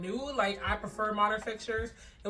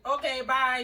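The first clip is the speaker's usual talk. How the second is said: higher, louder brush-off; dismissive; 5 semitones higher, 7 dB louder, slower than usual. Retold or imitated. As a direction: wave them off breezily. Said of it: "They were like".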